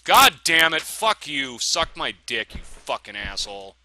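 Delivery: loud, high burst of anger; furious cursing. Real, not acted. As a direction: explode at the enemy, then ease down. Cursing at game enemy attacking.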